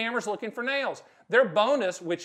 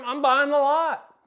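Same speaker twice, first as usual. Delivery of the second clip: louder, higher, slow and punched; emphatic verdict; 6 semitones higher, 9 dB louder, slower than usual. Real, not acted. Stan tells viewers what he'd do, loud and emphatic. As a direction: declare it like it's obvious.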